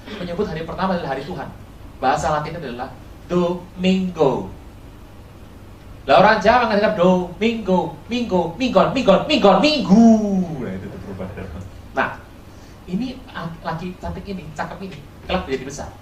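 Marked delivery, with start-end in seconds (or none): 6.1-10.1 Minggo, Minggon drifting into Minggu, getting louder; Javanese mishearing. Shown as a demonstration. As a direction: act out the Javanese ear turning Domingo into Minggu. (meniru lidah Jawa dari Domingo jadi Minggu)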